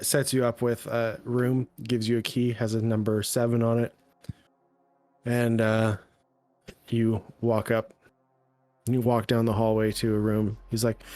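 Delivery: usual speaking voice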